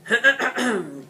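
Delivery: loud, formal throat clear. Acted. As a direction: clear your throat for attention. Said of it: "cleared his throat".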